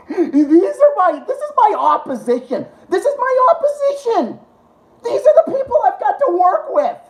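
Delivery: high-pitched exasperated rant with laughter; rising into incredulous, high insistence; wound-up, laughing disbelief. Real, not acted.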